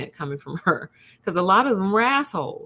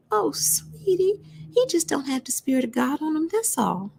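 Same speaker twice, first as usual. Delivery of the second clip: high, whispery sweet granny; grandma voice; 10 semitones higher than usual. Retold or imitated. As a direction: breathe it like a tender grandma. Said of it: "anytime I do the granny voice".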